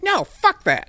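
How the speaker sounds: high, loud tantrum shout; mock bratty outburst; inner little kid. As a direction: yell like a defiant child. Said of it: "there's still a little kid part".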